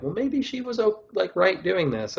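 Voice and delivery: high, sing-song devil's advocate; mock sympathetic framing. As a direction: pitch it up as if excusing her. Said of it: "play that card of, like".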